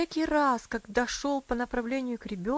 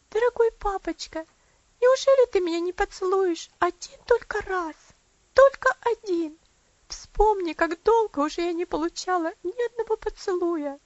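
meek pleading; 9 semitones higher, 9 dB louder than usual. A narrator's reading. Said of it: "умоляюще посмотрела... кротко спросила".

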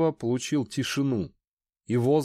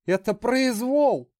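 higher, louder terrified shriek; panicked trapped animal; 10 semitones higher, 3 dB louder, slower than usual. Acted. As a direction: squeal in terror, slowly. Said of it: "завизжал от страха Зуд".

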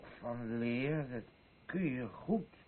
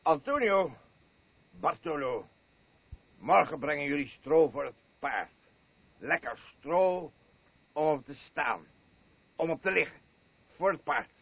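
louder bossy orders to the farmhands; commanding call; 8 dB louder than usual. Acted. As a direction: call the orders out across the yard. Ordering the men about straw.

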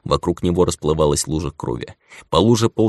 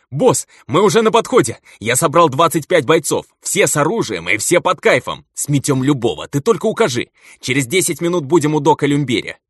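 much higher, rushing gang voice; overexcited; 9 semitones higher than usual. Acted. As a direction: blurt it out all wound up. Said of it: "полился перевозбуждённый незнакомый голос". (перевозбуждённый голос)

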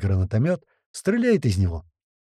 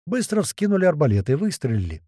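ordinary delivery, nothing marked

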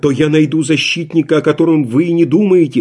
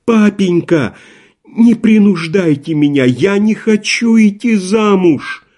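tearful pleading; 6 semitones higher than usual. A narrator's reading. Daughter begs her father not to force her marriage, tearful. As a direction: plead and keep pushing higher.

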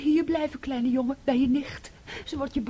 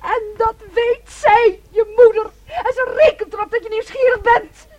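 sobbing accusation; 6 semitones higher, 8 dB louder than usual. Acted. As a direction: hurl it through sobs. Daughter sobs accusing mother. (luid en hoog, snikkend beschuldigend)

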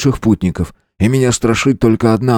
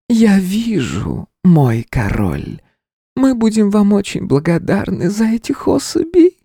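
seductive siren voice; 13 semitones higher than usual. A narrator's reading. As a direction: coax him slowly like a siren. Spider siren voice. (соблазнительный голос паучихи)

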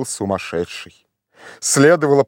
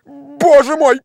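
very high, loud lament; desperate, high-pitched exclamation; despairing cry; 12 semitones higher, 7 dB louder, slower than usual. A narrator's reading. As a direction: cry out at your wits' end. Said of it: "как видно, в отчаянии".

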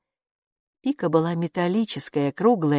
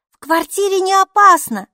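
angry rustle; 13 semitones higher, 7 dB louder than usual. A narrator's reading. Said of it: "сердито прошелестели за дверью".

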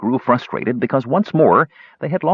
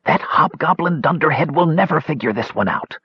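high, lightly conspiratorial stage whisper; 7 semitones higher than usual. A narrator's reading. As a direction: confide it gleefully, only half whispered. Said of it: "leaned toward Cronin and whispered".

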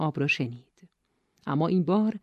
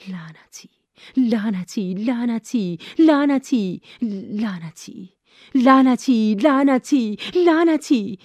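from a hushed whisper rising to high, loud frantic cries; the panic builds with every repeat until it breaks into a yell; panicked swearing; 6 semitones higher, 6 dB louder, slower than usual. A narrator's reading. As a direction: whisper at first, then climb into terrified shouting. Panic as the bear approaches.

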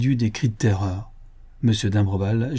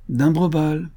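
higher, insistent second call; second hail, more urgent; calling out again; 6 semitones higher, slower than usual. A narrator's reading. Players call out his name.

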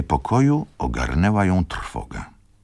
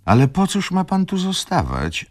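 put-on much higher young lady's voice, calling; Izabela calling out. A narrator's reading. Izabela urges Wokulski not to stay, high call. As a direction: raise the voice high as a pleading young woman. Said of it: "zawołała panna Izabela".

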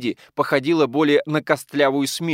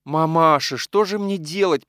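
high, drawn-out helpless wail; moaning; 6 semitones higher, 4 dB louder, slower than usual.

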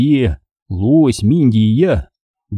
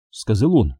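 usual speaking voice

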